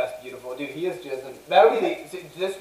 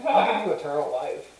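ordinary talk

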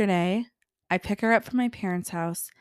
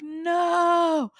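high no; 9 semitones higher than usual. Retold or imitated. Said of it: "And I'm like".

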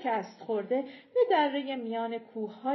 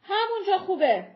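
louder, higher firm stop; stern warning; 9 semitones higher, 8 dB louder than usual. Acted. Leader warning the approaching giant to stop, stern. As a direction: halt him with a sharp word. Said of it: "کریستوبرت هشدار داد".

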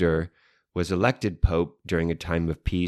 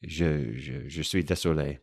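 put-on French accent; 3 semitones lower, 4 dB quieter than usual. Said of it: "You still sound French, though".

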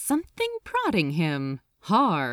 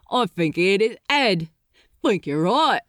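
rough stoker; 6 dB louder than usual. Acted. Stokers talking among themselves.

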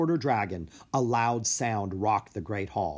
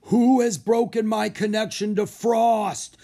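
loud, raised giant's voice; booming giant; 10 semitones higher, 7 dB louder than usual. A narrator's reading. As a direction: boom the question down at them. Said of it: "the giant asked in a booming voice".

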